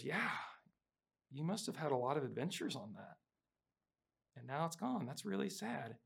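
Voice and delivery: lightly voiced, soft sympathetic character; Sadness. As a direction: gently imitate a sad comforter, softly. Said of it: "And Sadness goes".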